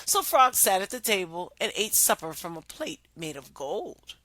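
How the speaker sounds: ordinary delivery, nothing marked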